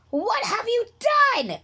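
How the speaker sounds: high shrill-free shriek of alarm. A narrator's reading. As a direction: shriek it out in shock. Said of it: "she shrieked".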